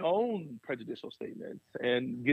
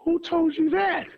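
pointed question; 12 semitones higher, 4 dB louder than usual. Retold or imitated. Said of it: "they go".

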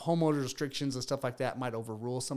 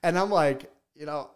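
usual speaking voice

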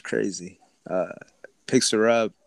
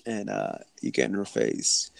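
ordinary delivery

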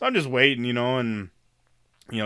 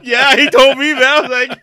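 laughing; 12 semitones higher, 13 dB louder than usual. Real, not acted. Laughing retelling the clerk's scolding.

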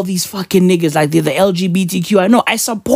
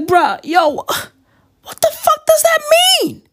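much higher, slow, stretched-out disbelief; incredulous; slower than usual. Retold or imitated. Retorting to [name]'s speech, high incredulous squeal. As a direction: squeal it like you can't believe what you heard. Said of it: "And I was like".

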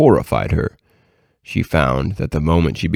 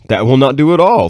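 higher, louder exclaimed objection; exclaiming; 6 semitones higher, 5 dB louder than usual. A narrator's reading. Satan turning down an imp's scheme, exclaiming. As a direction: exclaim it as a storyteller voicing a firm refusal. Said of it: "exclaimed Satan".

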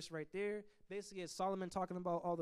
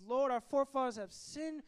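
higher, louder pleading cry to the Lord; pleading prayer; 6 semitones higher, 3 dB louder than usual. Retold or imitated. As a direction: cry out to God for mercy. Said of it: "He's crying out".

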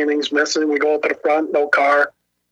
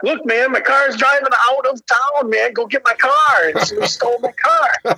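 higher-pitched, exasperated plea to hurry up; irritated retelling; 7 semitones higher than usual. Retold or imitated. Man urging a cop to chase his stolen car, irritated. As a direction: act out fed-up urgency, pitched up. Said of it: "irritated with him".

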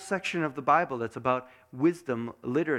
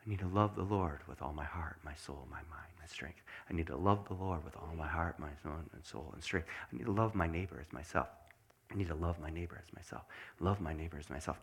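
low, very soft, hushed muttering; near-whispered private recitation; muttering to oneself; 8 semitones lower, 10 dB quieter than usual. Shown as a demonstration. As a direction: mutter it under your breath, over and over. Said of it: "you mutter them to yourself".

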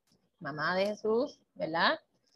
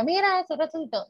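higher, eager announcing; excited news; 8 semitones higher than usual. Retold or imitated. Magdalena telling the apostles the news, high and eager. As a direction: burst out with the good news.

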